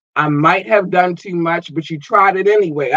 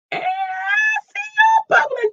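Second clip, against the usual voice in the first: far higher squealing shout; hyped falsetto cheer; excited squeal. Real, not acted. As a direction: scream it with delight at the fans. Repeats line much higher.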